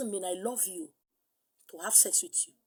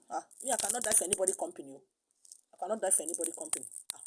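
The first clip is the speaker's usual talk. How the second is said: fast mock complaint; 3 semitones lower, 5 dB quieter, much faster than usual. Imitating a careless worker.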